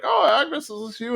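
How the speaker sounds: put-on very high old-lady voice; high, thin-pitched mock old woman. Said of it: "an old lady comes out".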